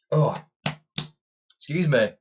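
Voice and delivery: ordinary delivery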